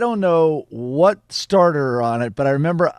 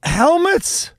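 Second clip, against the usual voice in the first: high-pitched mom impression; imitated mother; 8 semitones higher than usual. Friend mimics host's mom recalling her invention, high imitation. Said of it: "I can't do the accent exactly".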